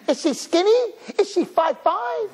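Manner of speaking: high, insistent questioning; mimicked eager suitors. Boys asking about a shidduch, high mock-eager questions. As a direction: press for it eagerly. What suitors really ask.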